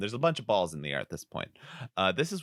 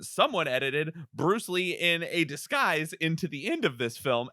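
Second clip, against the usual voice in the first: normal talking voice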